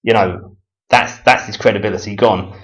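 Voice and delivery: ordinary talk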